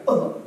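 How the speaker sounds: short, loud "oh"; sudden burst from the belly; punched-stomach grunt. Shown as a demonstration. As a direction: grunt as if hit in the gut. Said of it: "like that, right?".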